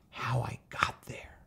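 hushed whisper, slow; confiding whisper. Acted. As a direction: let him in on a secret. Teen revealing secret.

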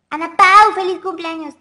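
loud, high burst of affection; excited birthday shout. Real, not acted. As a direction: shout out a birthday greeting to a fan. Calling out a birthday greeting. (felicitación gritada con emoción)

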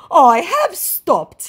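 high, loud, very slow protest; hammed-up declaration; insisting scammer. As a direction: drag it out in a squeaky put-on voice. Voices scammer's repeated claim.

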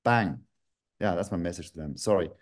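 ordinary delivery

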